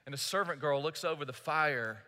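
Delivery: usual speaking voice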